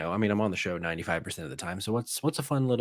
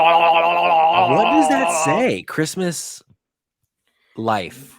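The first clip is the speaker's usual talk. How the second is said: very slow, loud, stretched-out question; drawn-out reading; 7 semitones higher, 8 dB louder, much slower than usual. Real, not acted. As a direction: drag every word out slowly in disbelief. Rereads the shirt drawn out.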